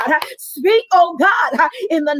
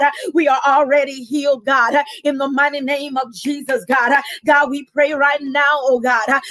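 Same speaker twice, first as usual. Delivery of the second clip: ordinary talk, nothing marked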